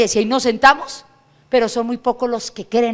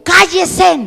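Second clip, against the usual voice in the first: sharp loud command to be silent; stern order; 6 semitones higher, 8 dB louder than usual. Retold or imitated. The Lord ordering the preacher to hush, loud and stern.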